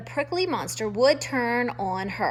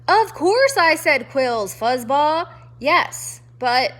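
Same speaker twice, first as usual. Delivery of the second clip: loud gruff retort; 3 semitones higher, 7 dB louder, slower than usual. Acted. Irritated porcupine.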